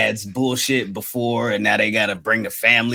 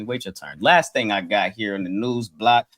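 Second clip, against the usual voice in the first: ordinary delivery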